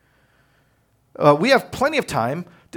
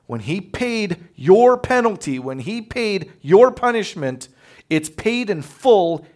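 preaching emphasis; 6 semitones higher, 6 dB louder, slower than usual. Acted. Preaching about Jesus' sacrifice.

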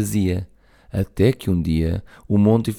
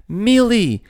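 high, louder telling-off call; shouting; 12 semitones higher, 5 dB louder than usual. Acted. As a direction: call her name sharply, high. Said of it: "gritou o Monty". (grito zangado, agudo)

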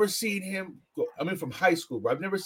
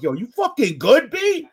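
mock tough guy; 9 semitones higher, 7 dB louder than usual. Friend imitating his confrontation.

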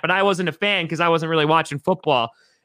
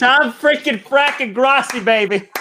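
hype intro; 7 semitones higher than usual. Acted. Hyping the guest's name.

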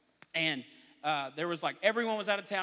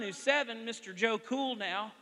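ordinary delivery

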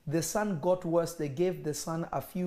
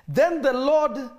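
solemn scripture reading; 7 semitones higher, 5 dB louder, slower than usual. Acted.

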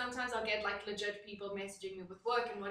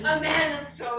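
mock macho voice; 5 semitones higher, 10 dB louder than usual. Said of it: "mock entitled man".

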